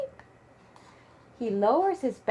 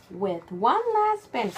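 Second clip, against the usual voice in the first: ordinary delivery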